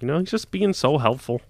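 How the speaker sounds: plain reading voice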